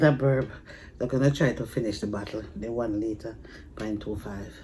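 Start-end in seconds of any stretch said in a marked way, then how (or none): none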